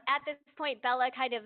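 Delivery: usual speaking voice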